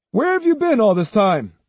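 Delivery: high-pitched, insistent demand. A narrator's reading. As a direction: demand an explanation from a truant. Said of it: "Ryohei demanded".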